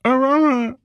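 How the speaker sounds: high, goofy dog voice; dog's voice. Retold or imitated. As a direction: play the dog, high and silly. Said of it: "This is what he wrote".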